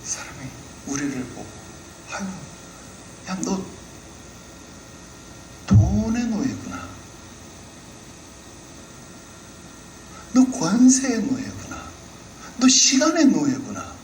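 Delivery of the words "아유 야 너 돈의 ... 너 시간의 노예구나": higher, slowed sneer at our slavery; a stranger scoffing at believers; mocking jeer. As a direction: scoff at them, word by word. A third party mocks us.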